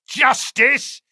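high scornful snarl. A narrator's reading. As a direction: spit the word back. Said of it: "snarled the convict".

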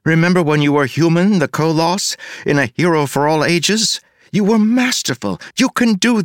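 coaxing, building praise, pitch rising; encouraging. A narrator's reading. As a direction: talk him into it, all praise. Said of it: "I said encouragingly".

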